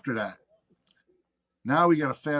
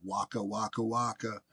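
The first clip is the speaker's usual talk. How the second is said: quiet teasing taunt; 2 semitones lower, 8 dB quieter than usual. Retold or imitated. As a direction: tease him under your breath. Taunting singer from side of stage.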